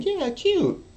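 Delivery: very high, loud mock cooing; a woman baited into the compliment; hammed-up woman's voice. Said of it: "I bait them into saying".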